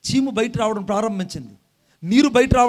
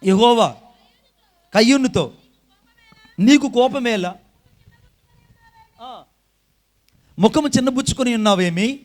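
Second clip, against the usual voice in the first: fiery preaching; 2 semitones higher, 6 dB louder than usual. Acted. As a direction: thunder the warning at the congregation. Preaching scripture reading.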